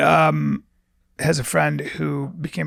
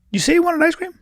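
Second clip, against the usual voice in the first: imitating Steve; 13 semitones higher, faster than usual. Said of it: "looks at me and goes".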